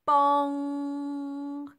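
long, loud ringing bong like a huge bell; be Big Ben striking. A narrator's reading. Big Ben chimes above Elsie, a loud held bong.

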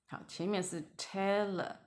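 ordinary talk, nothing marked

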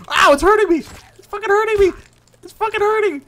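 very high, very loud yelps of pain; screaming while being mauled; pained screams. Real, not acted. Player being attacked, screaming in pain.

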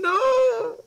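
high, loud, despairing scream; anguished scream. Pretend. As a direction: scream like your world just ended. Mock dramatic outcry.

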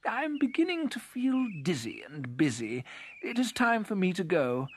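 a hint of a little beetle getting eager to leave; lifted, restless character voice; spotted beetle, restless. A narrator's reading.

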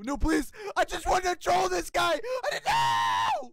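high desperate begging, slowing at the end; begging wail; panicked pleading. Real, not acted. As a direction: plead for mercy as you lose. Getting killed by a better player.

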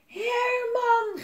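high, loud drawn-out shout of a name; joyful yell. A narrator's reading. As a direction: yell to a long-lost friend. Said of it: "gilde Jelmer".

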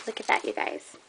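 breathy whispered wonder; whispering. Real, not acted. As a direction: whisper it in awe. Hushed admiring of dewdrops.

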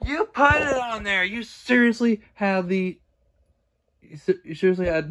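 loud, high, angry yell. Real, not acted. Creator yelling at a fan making fake pages, furious. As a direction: raise your voice and let the anger out. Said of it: "I'm sorry for yelling".

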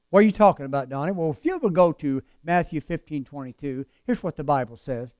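ordinary talk, nothing marked

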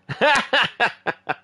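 high burst of laughter; real, high-pitched laugh. Real, not acted. Caller's Glasgow accent tickles the host, high laugh.

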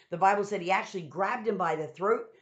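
ordinary talk, nothing marked

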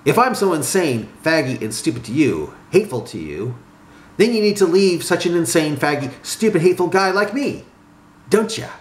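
hurt retort climbing in pitch; wounded, heated comeback; growing wildness. A narrator's reading. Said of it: "growing wildness in his eyes".

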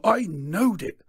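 loud, high-pitched outburst; pompous cry of suspicion confirmed; triumphant accusation. A narrator's reading. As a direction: burst out, vindicated and indignant. Clarence P's triumphant accusation.

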